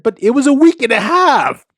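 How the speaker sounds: high, loud self-defence, retold; hammed-up indignant rebuttal; mock protest. Retells own defence to wife.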